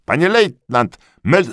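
high, loud servile report; eager corporal. A narrator's reading. Corporal reporting to the lieutenant, high and eager.

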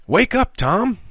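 high bright call; cried out. Acted. Harry rousing his dazed friend, high cry. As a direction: jolt him awake with a high cry. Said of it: "he cried".

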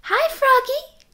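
put-on high, slow sing-song girl frog; a sweet high call drawn out; 1 Froggy Lena. A narrator's reading. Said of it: "cried Froggy Lena".

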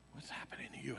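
hushed, anxious whisper; whispered worried question. Retold or imitated. Wife asking him at his chair, whispered. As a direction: whisper it close so no one hears.

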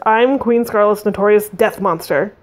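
plain reading voice

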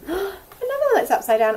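mock surprise. Another flipped card.

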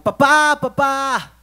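grito agudo y fuerte de niño; llama a papá a gritos, muy agudo; niño gritando papá. Retold or imitated. (grito infantil muy agudo y fuerte)

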